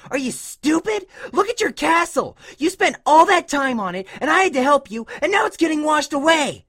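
high, loud exasperated rant; angry scolding. A narrator's reading. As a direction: berate the kid at a high pitch. Dash berates Scootaloo over the castle.

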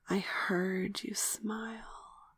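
ordinary delivery, nothing marked

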